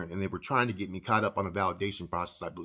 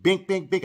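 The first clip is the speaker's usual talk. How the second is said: punch sound effects; 7 semitones higher, 4 dB louder than usual. Retold or imitated. Acting out the punches.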